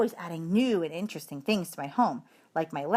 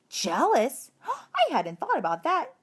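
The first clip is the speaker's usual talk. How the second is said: gasping surprise; 7 semitones higher than usual. Acted. Hamster alarmed by a new worry.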